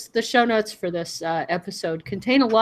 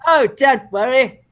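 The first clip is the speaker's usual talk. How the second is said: raised, hammed-up English accent; mock English accent; 4 semitones higher, 4 dB louder than usual. Co-host playing onlooker reassuring a dear, mock English accent. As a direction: put on a playful English accent. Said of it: "I wish I could do their accent".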